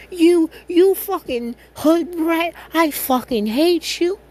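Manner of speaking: slow, high, loud enraged screech; furious yelling. Retold or imitated. Ida screaming insults at Deku in a furious, high rage. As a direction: spit out the insult in a hammy rage.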